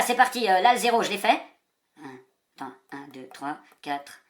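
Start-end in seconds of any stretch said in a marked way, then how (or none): 2.0-4.2 slow, soft murmured counting; counting under breath. Real, not acted. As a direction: count to yourself quietly.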